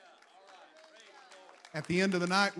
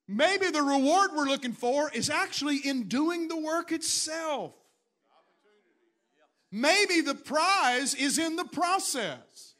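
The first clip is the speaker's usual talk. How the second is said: loud, high, slow preacher's cry; preaching at full force; 8 semitones higher, 8 dB louder, slower than usual. Acted. Pastor preaching to his church in a loud, slow cry. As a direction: build it up like a pulpit climax.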